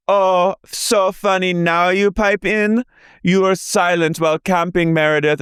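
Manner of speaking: slow, high mocking parody; imitating Whitney. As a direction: drag out the sarcastic jab. Mimicking Whitney's jab.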